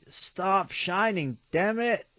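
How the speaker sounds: frustrated shout. Acted. Hurt man shouting at the blazing sun, fed up. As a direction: yell up at the sky in exasperation.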